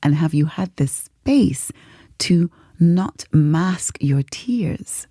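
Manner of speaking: slow, measured retold therapist; therapist questioning. Therapist asks client about space to cry, slow measured questioning. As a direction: probe gently, spacing out each phrase. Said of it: "that's what the therapists do".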